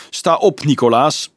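called out high and loud. A narrator's reading. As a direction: Dolf pulls the kneeling shepherd boy up, calling out loudly. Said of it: "zei hij luid".

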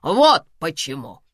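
loud, emphatic proud declaration. A narrator's reading. Karina explains why she spared Samvel, proudly. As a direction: announce it triumphantly. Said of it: "гордо заявила Карина".

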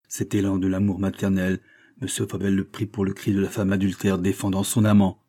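ordinary delivery

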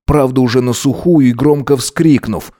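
ordinary delivery, nothing marked

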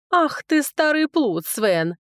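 high, playful teasing exclamation; giggly. A narrator's reading. Flattered woman teasing the old rogue, giggly. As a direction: start high and coy, then drop. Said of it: "хихикнула Марилька".

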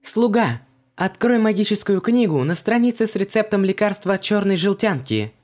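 high, ringing order; loud command. A narrator's reading. Heroine orders her magic servant, loud command. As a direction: command it loudly, pitched high. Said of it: "громко приказала".

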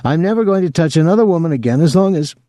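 high, rushed vow that breaks off; choked up. A narrator's reading. Rejected boy swearing off women, choked up. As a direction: blurt it high and fast, then stop short. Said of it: "too choked to say anything more".

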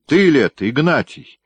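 high, loud shout of disbelief. A narrator's reading. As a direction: call out across the yard. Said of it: "крикнул он". (громкий высокий окрик: «Ты ли это, Игнатий?»)

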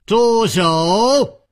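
high, drawn-out commanding cry; commanding shout. Acted. Newcomer breaks up the fight, high commanding shout. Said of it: "突然有一个人喝道".

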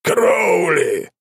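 loud eerie name shout. A narrator's reading. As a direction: announce yourself like a monster. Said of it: "жуткий голос".

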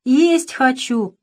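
put-on slow, thin child's whine; thin drawn-out little voice. A narrator's reading. Little child whines to big brother, slow and thin. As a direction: drag the words out like a hungry toddler. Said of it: "протянул другой тоненький голосок".

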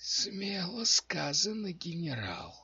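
high, slow, raspy whisper from the dark; hoarse whisper. A narrator's reading. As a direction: rasp it out like a hidden necromancer. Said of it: "раздался хриплый шёпот из темноты". (хриплый шёпот из темноты)

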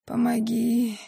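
soft, weak, rasped plea; faint croak for help; hoarse plea. A narrator's reading. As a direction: wheeze out a cry for help. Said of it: "прохрипела я".

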